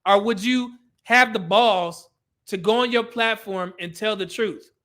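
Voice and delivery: ordinary delivery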